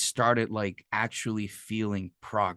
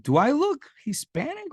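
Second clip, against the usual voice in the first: very high, louder mock question; imitated indignant comeback, pitched way up; incredulous retort; 5 dB louder than usual. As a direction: squeak it out in mock disbelief. Imitates coach's witty retort.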